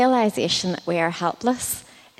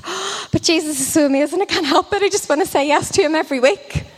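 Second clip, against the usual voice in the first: put-on high, gushing kid who can't contain it; excited Josh; 8 semitones higher, faster than usual. Boy answering his leader about saying yes to Jesus, excited. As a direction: burst out in breathless, high-pitched delight. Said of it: "looked at me with excited eyes and just said".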